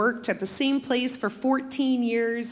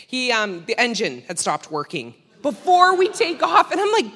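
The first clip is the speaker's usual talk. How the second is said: ordinary talk, nothing marked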